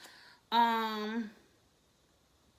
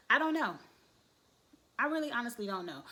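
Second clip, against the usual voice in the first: exasperated; 3 semitones higher, faster than usual. Real, not acted. Host at a loss about next week, exasperated. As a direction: throw up hands on I don't know.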